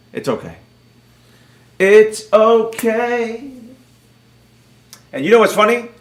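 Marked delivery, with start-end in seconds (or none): 0.1-0.6 low, soft aside; hushed and falling away; quiet resignation. Real, not acted. Muttered resignation, then drawn-out repeat. 1.8-3.5 very slow, rising singsong; long, lilting, extra slow; drawn-out repeat. Real, not acted.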